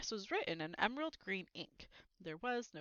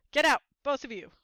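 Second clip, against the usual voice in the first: loud, higher strained order; croaked order; 6 semitones higher, 10 dB louder than usual. A narrator's reading. Uncle ordering both boys out, loud and strained. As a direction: force it out hoarsely. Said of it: "croaked Uncle Vernon".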